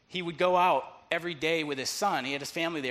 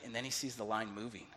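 usual speaking voice

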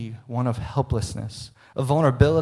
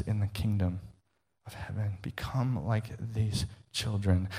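hushed emphasis; 5 semitones lower, 11 dB quieter than usual. Real, not acted. Sermon point sinks to near hush.

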